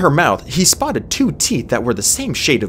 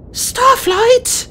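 very high, squeaky questioning call; worried, timid high voice; anxious; 3 dB louder than usual. A narrator's reading. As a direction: call the name uncertainly, pitched way up. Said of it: "Fatespeaker whispered anxiously".

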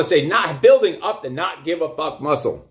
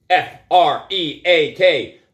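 spelled out slowly; slower than usual. Shown as a demonstration. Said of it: "break down the freak template".

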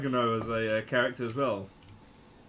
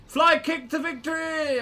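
mock battle cry; 12 semitones higher, 5 dB louder than usual. Player launching a flying kick, mock battle cry. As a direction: shout it like a hammed-up attack call.

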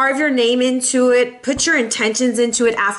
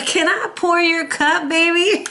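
a light, higher flirty Emperor voice; smooth suitor; 6 semitones higher than usual. Acted. Emperor card wooing the querent, lightly flirty. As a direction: sweet-talk her with a lightly voiced flirt. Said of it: "the Emperor says".

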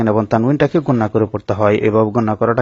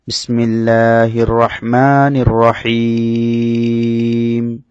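slow, drawn-out chanted basmala; measured Quranic chant; tajweed recitation; much slower than usual. Shown as a demonstration. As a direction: stretch রহমানির long in recitation. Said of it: "তাজউদের নিয়মগুলি আপনাদেরকে স্মরণ করিয়ে দেই".

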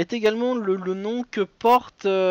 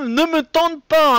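mock warning; 9 semitones higher, 7 dB louder, slower than usual. Real, not acted. Host teasing about Squeezie.

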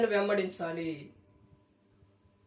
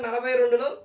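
normal talking voice

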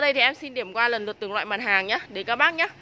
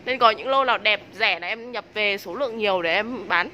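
ordinary talk, nothing marked

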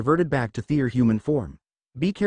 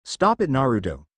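plain reading voice

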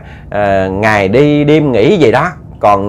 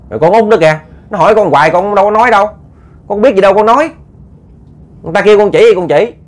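raised protesting son; 7 semitones higher, faster than usual. Acted. Son protests to angry parents.

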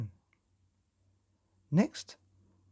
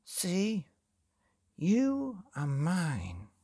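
mildly raised voice, higher and drawn out; loud voice; 6 semitones higher, much slower than usual. A narrator's reading. Fausto claiming the sea from his boat, mildly loud. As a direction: call out slowly without really shouting. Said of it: "said in a loud voice".

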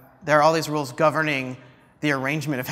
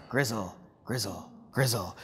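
soft, strange dinosaur murmur, much quieter; whispering dinosaurs; 4 semitones lower, 9 dB quieter than usual. Acted. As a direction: murmur it like distant beasts. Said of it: "a chorus of whispering dinosaurs".

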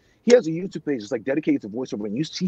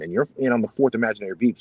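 normal talking voice

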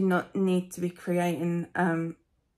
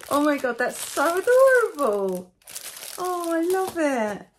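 higher and louder, gushing; delighted cooing; 9 semitones higher, 4 dB louder than usual. Real, not acted. Showing viewers the biscuit bag, she gushes, higher and louder. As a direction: swoon over the little bag.

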